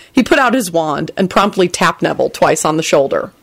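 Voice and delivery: ordinary delivery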